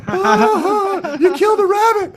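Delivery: very high, loud horrified wail; hammed-up falsetto accusation; outraged hippie. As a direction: shriek it in mock grief. Hippies accusing trucker in joke.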